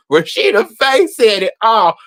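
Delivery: normal talking voice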